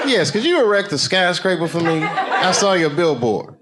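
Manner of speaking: polite mock customer. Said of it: "another imagined caller".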